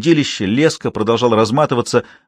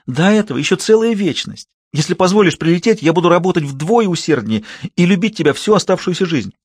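a daughter begging her mother on the phone; squealing girl; 8 semitones higher than usual. Acted. Kayla begging again. (дочь визжит и умоляет маму в трубку)

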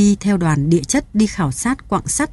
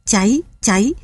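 ordinary delivery

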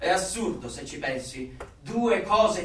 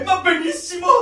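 delighted; 10 semitones higher, 9 dB louder than usual. Acted. Delighted answer about dictionary.

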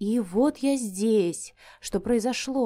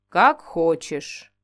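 indifferent talking tree; 5 semitones lower, 3 dB louder, much slower than usual. Acted. Tree gives up.

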